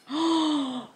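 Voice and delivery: sharp, high audible gasp; big thrilled intake of breath; delighted gasp. Real, not acted. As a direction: suck in air in excited surprise. Delighted by new planner page.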